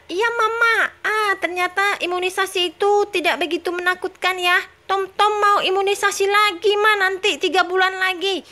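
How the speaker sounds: put-on high childlike baby voice; high-pitched little baby talking. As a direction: answer mama like a sweet toddler. Baby Tom Tom after the shot.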